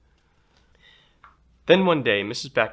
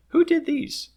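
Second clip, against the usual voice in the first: delighted teacher; 7 dB louder than usual. Acted.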